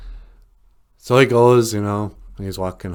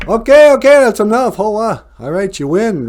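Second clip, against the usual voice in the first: excited referee shout; 12 semitones higher, 10 dB louder than usual. Retold or imitated. Retold referee stops the fight.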